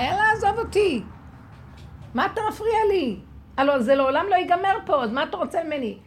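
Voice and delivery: high, loud fed-up yell; exasperated shout. Retold or imitated. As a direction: shoo it away in annoyance. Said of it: "היא אמרה". (צעקה רמה ומתעצבנת)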